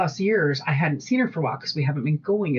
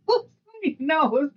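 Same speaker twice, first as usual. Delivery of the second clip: ordinary delivery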